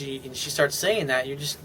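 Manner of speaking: ordinary delivery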